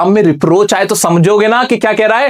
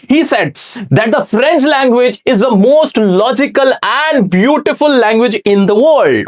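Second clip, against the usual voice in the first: slow textbook reading; 5 semitones higher, much slower than usual. Shown as a demonstration. Teacher reads the English text to students, slow and deliberate. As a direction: read each English word out slowly and clearly.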